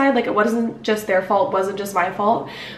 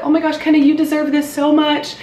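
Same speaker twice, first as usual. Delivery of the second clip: higher, louder, gushing congratulations; excited friends; 6 semitones higher, 3 dB louder than usual. Retold or imitated. Friends congratulating Kenna, higher louder excited gush. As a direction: cheer her on like proud friends. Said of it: "my friends were all like".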